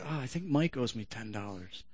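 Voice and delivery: a listener muttering to himself; mock congregation member. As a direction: toss it off like a distracted churchgoer. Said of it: "some of you are like".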